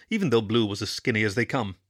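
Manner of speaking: usual speaking voice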